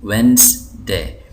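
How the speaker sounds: slow वेंसडे split as WENZ, day; correct pronunciation. Shown as a demonstration. Teacher repeating for learners, वेंसडे slowly in two parts. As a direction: sound out वेंसडे with silent D. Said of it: "अंग्रेज़ इसको पढ़ते हैं".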